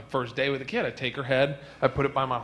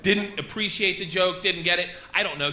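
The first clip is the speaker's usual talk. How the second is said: usual speaking voice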